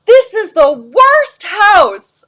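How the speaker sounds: loud, high, drawn-out yell; child's outraged yell. Acted. Son shouting at a stranger's front door, outraged yell. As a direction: imitate an indignant kid shouting it slowly. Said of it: "Cooper is yelling".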